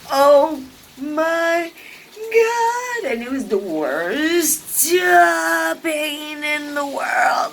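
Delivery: high, slow, cracking cry of pain. Real, not acted. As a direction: voice breaking as you relive it. Retold agony, crying now.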